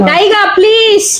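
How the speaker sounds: high, slow, stretched-out pleading; exasperated, high-pitched begging; drawn-out plea. Real, not acted. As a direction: whine for them to stop, dragging it out. Pleading with interrupting co-host.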